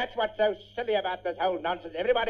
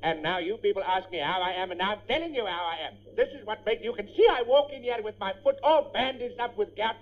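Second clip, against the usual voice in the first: ordinary talk, nothing marked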